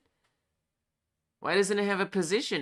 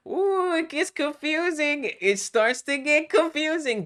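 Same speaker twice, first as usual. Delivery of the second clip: playful mock spooky; 11 semitones higher, 8 dB louder than usual. Teasing about confusing code.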